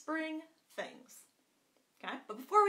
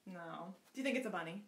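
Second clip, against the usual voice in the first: ordinary delivery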